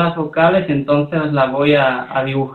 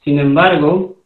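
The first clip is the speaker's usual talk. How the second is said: usual speaking voice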